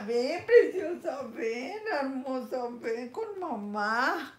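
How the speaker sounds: sweet call to her cat, laughing; coaxing the cat. Real, not acted. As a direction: coax the kitty over, slowly.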